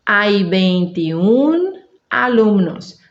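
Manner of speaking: very slow, UN in "veintiún" held long; slow demonstration. Shown as a demonstration. Teacher showing learners the dropped final O, slow demonstration. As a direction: separate "veintiún" from "alumnos" with a clear break. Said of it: "vou dizer assim".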